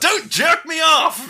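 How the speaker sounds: high, slow, loud mock yell; mock shout. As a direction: bellow it like a cornered hostage taker. Hostage taker screaming at cops.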